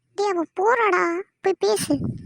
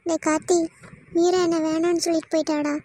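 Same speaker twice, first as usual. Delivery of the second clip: ordinary talk, nothing marked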